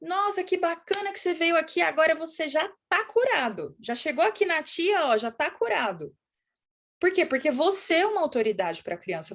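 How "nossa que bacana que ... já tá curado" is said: high, upbeat doctor talking to a little one; delighted, reassuring kid-talk; encouraging a child. Shown as a demonstration. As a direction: cheer the child on in a raised, lilting voice. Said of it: "Você vai olhar pra criança e vai falar".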